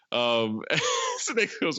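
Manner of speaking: ordinary talk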